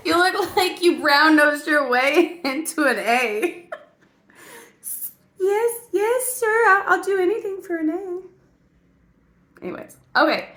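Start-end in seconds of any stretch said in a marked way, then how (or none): 0.1-3.7 slow, laughing through the insult; laughing mockery. Mocking own face in the mirror, laughing. 5.4-9.6 high, put-on eager-to-please voice; fawning student. Student begging teacher for an A, fawning.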